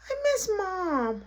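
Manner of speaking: high, drawn-out whimper; imitating a tearful little kid; whiny little boy. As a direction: whine like a child missing mommy. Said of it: "Stop crying".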